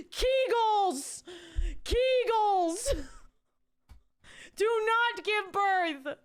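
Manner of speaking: high, loud yelling through laughter; laughing mock shout; hammed-up frantic yell. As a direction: scream orders like a panicked coach.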